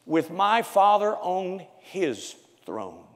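slow, measured proclamation; solemn scripture reading. Acted. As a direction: read each word with weight.

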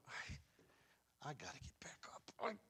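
a hushed broken man urging himself up; whispered resolve. Retold or imitated. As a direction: whisper it to yourself, broken but rising. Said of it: "he started going".

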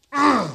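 loud disgusted ugh; a sharp fed-up ugh; exasperated groan. Acted. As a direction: groan it out in frustration. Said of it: "he's so mad".

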